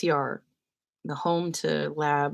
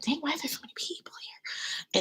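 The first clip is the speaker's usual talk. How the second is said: soft unvoiced whispered grumble; whispered aside; 10 semitones higher, 9 dB quieter than usual. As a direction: whisper it in disbelief. Muttered reaction at crowded restaurant.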